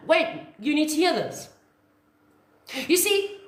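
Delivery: ordinary talk, nothing marked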